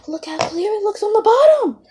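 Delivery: high and loud with excitement; thrilled, calling out; excited. Real, not acted. Excited at clear slime.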